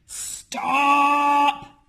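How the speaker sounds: captain yelling at the pirates to stop. A narrator's reading. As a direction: bark the order to halt. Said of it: "yelled Handkerchief".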